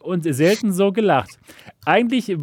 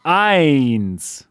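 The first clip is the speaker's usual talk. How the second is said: booming prize announcer; much slower than usual. Acted. Winner reveal countdown.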